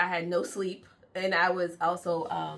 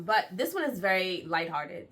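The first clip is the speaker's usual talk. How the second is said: usual speaking voice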